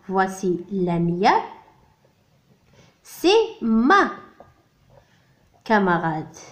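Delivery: slow, careful "voici Lamia"; careful teacher demo; slow model phrase. Shown as a demonstration. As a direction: model it slowly for the class. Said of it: "نقول voici".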